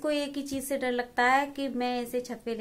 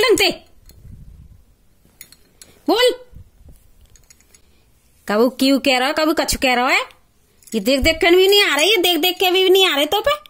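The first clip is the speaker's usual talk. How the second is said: angry scolding; 4 semitones higher, 11 dB louder than usual. Real, not acted. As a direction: scold him hard, threatening a slap. Mother scolds son over spelling. (गुस्से में बच्चे को डाँटती माँ)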